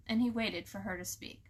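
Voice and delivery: usual speaking voice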